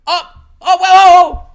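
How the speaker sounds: loud, high surprised whoas; sudden loud surprise; startled whoa. Real, not acted. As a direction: caught off guard, cry out. Surprised by someone appearing.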